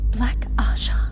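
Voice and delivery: hushed, breathless whisper; whispered suspicion. Acted. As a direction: breathe the name like a secret. Secret suspicion whispered in private.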